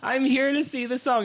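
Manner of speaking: high-pitched, hammed-up wail of words; dramatic plea. Hand on the wall, begging usher, dramatic plea. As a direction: re-enact a melodramatic plea. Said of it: "It was so dramatic".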